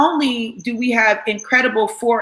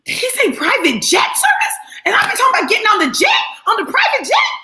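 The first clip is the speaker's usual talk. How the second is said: high, fast and laughing; excited disbelief; 10 semitones higher than usual. Retold or imitated. Hearing about the private jet prize, high laughing disbelief. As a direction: squeal out the news of the jet. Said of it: "I said".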